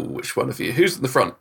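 ordinary talk

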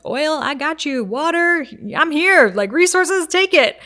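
high, loud giving-earth voice; the earth handing everything out; playful voice of the earth as mother offering its gifts; generous earth mother. Pretend. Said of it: "It's like".

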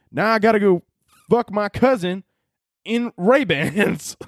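high-pitched, drawn-out southern yokel rant; southern accent. Acted. Ranting as an angry commenter at Pit Viper, exaggerated southern accent. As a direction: lay on a mock-outraged southern drawl. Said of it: "the inbreds are yelling again".

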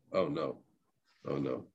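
low, hushed little groan of dismay; quiet dismay. Retold or imitated. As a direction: sink into a soft, deflated "oh no". Finds someone in his seat.

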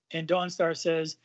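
normal talking voice

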